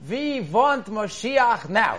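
put-on loud, slow, high child's chant in Russian accent. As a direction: call it out slowly like a Russian boy. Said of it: "With this beautiful Russian".

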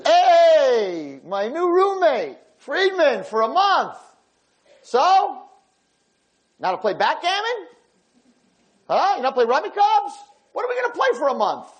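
high, bright-voiced eager old roommate; cheery old man. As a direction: greet him like a happy old neighbour. Lefkowitz cheerfully greets new roommate.

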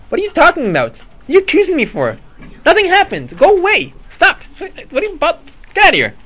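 high, loud outraged denial; indignant shouting. Acted. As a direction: fake outrage, building to a shout. Rashid denies the debt, escalating.

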